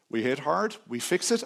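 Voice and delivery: ordinary talk, nothing marked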